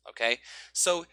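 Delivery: ordinary delivery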